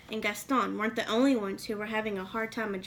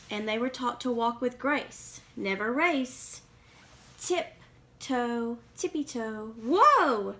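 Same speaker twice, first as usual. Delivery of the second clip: put-on lively read-aloud for kids; storybook reading; much slower than usual. A narrator's reading. Reading a picture book to young listeners, lively storytelling.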